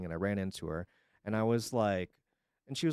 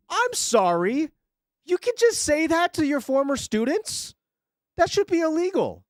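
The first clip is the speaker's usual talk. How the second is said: outraged; 13 semitones higher, 4 dB louder than usual. Real, not acted. Outraged at teacher's remark.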